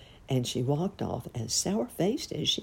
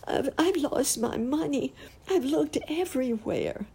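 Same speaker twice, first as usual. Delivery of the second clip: put-on high, sobbing little-girl voice; acted wail of a little girl in tears; tearful sobbing; 11 semitones higher than usual. A narrator's reading. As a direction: stammer it out high, as if crying. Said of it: "she sobbed".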